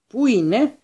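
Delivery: put-on high, loud and piercing old man's cry; a high, grating old official demanding answers; shrill old wizard. A narrator's reading. Committee wizard searching for the beast, high and piercing. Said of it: "η στριγγιά φωνή του γερομάγου".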